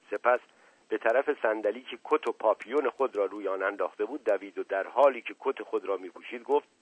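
plain reading voice

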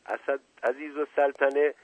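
usual speaking voice

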